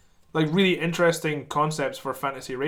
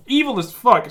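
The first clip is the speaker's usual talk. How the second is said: excited emphatic; 7 semitones higher, 7 dB louder than usual. Real, not acted. Excited answer about Skaven.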